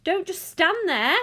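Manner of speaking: high, loud, drawn-out scolding; screamed order. A narrator's reading. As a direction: scream at your useless husband. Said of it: "Missus Gloop screamed at Mister Gloop".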